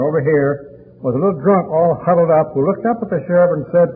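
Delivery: ordinary talk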